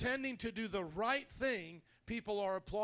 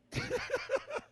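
high-pitched burst of laughter. Real, not acted. Laugh after flirty line.

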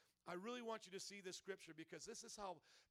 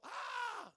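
sharp high cry of labour pain; 8 dB louder than usual. Pretend. Birth pain cry in sermon.

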